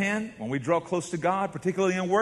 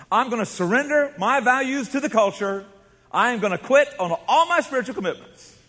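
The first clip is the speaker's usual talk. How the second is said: mock backslider voice; 7 semitones higher, 3 dB louder than usual. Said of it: "nobody decides".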